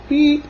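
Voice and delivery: very high, short beep; truck horn beep. A narrator's reading. Blue answers the animals, high beep. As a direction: squeak out a quick horn beep. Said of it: "Blue said".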